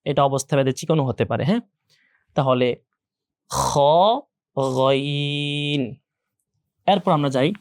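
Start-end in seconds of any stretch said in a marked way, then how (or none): 3.5-5.8 scraped খ, then long level গইন, both rounded; heavy throat letters. Shown as a demonstration. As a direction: model খ and গইন slowly from the throat. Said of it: "এগুলো সবসময় মোটা হবে". (গলা থেকে টেনে মোটা খ, গইন)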